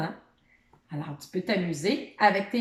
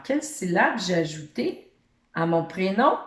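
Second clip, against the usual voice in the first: ordinary talk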